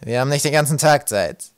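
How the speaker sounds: loud, impatient growl; testy creature voice; irritated growl. A narrator's reading. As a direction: grumble it out testily. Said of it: "knurrte Ratz gereizt".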